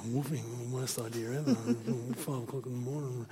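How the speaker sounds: sigh, then muttered complaint; sleepy grumbling. Retold or imitated. Volunteer dragged up at five, sighing and grumbling. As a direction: grumble softly to yourself. Young self grumbling at early start.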